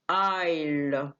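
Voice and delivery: آيل said slowly with no s; silent s. Shown as a demonstration. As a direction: draw out آيل, no s sound. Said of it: "شوف مفيش إس".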